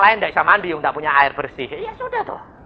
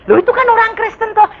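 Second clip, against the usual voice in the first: surprised question; 12 semitones higher than usual. Retold or imitated. Preacher voicing a shocked listener, high and surprised. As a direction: act shocked that Christians do this.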